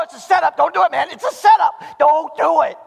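loud, high mock warning; urgent warning to buddy. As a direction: warn your buddy urgently. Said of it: "look at your buddy and just go".

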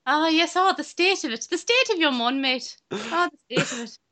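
high-pitched mock Irish lilt; exaggerated fake Irish accent; crap Irish accent. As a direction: put on a silly stage-Irish voice. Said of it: "I can do a really good Irish accent".

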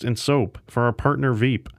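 usual speaking voice

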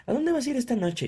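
lightly voiced cheery app; app's chirpy question. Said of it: "Y las plataformas así de". (app de transporte preguntando, con tono alegre)

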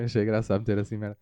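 normal talking voice

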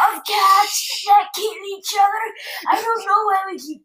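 put-on high, slow, quavering elderly voice; old lady voice. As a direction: play a frail old podcaster. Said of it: "eighty-five years old".

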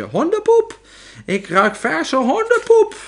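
very high, loud shrieking witch; witch crying out. Acted. Witch smelling the hidden child among them, shrieking. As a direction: shriek it in alarm, pitch right up.

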